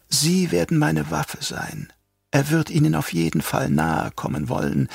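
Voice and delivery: soft, quietly fanatic Yui; lightly voiced traitor doctor. A narrator's reading. Yui's gloating plan.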